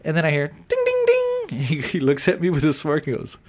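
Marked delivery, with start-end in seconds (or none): 0.5-1.6 high sung ding ding like a card reader; chirpy electronic ding; register chime imitation. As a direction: mimic the machine's chime, way up high.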